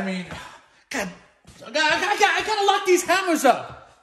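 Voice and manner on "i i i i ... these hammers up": frazzled laugh. Acted. Dad frazzled after smash.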